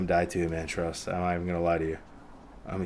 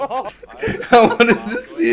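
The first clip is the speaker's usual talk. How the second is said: laughing; 13 semitones higher, 7 dB louder, much slower than usual. Real, not acted. Laughing after the close fight.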